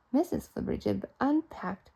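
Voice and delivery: plain reading voice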